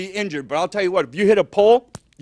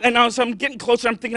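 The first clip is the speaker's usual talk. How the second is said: ordinary delivery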